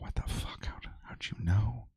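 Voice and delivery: mock astonished whisper; hushed, imitated disbelief; stunned whisper. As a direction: whisper it like a client amazed you knew. Acting out a stunned customer.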